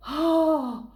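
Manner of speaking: loud, high scared oh; frightened roar. Acted. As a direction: cry out in fright. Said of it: "rugit le monstre".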